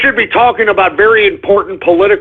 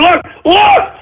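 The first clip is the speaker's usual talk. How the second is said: outraged; 10 semitones higher than usual. Real, not acted. Reacts to disgusting tweet.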